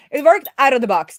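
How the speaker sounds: excited. Real, not acted. Celebrating the form working.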